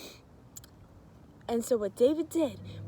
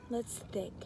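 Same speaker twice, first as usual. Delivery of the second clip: whispered aside; playful thinking whisper; 6 dB quieter than usual. Real, not acted. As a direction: breathe it out thinking. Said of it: "unvoiced whisper".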